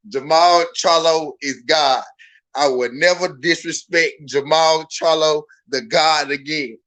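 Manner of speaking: slow, measured pledge; say it meaningfully. Shown as a demonstration. Streamer showing a bettor what to say, slow and deliberate. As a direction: dictate it firmly, one weighty word at a time. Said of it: "say it just like that".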